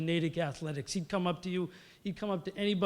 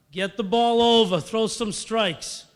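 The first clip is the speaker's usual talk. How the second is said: loud, raised-pitch shout from the stands; retold heckling yell; 5 semitones higher, 9 dB louder, slower than usual. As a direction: imitate a mother yelling at the pitcher. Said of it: "to stop yelling at me".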